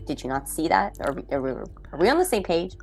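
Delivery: ordinary talk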